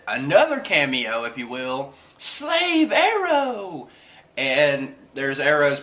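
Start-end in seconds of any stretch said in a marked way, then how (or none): 2.2-3.9 high, drawn-out battle cry; slow, high-pitched anime attack shout; imitated attack call. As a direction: stretch out the move's name dramatically. Acted attack name being called.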